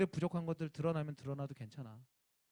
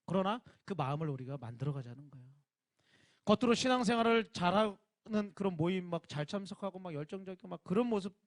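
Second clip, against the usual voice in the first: ordinary talk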